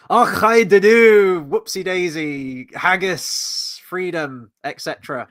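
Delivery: loud, slow, hammed-up Scottish brogue that fades out; mock Scottish accent. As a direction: start loud and slow in a jokey Scottish voice, then trail off. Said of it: "I can't do a Scottish accent".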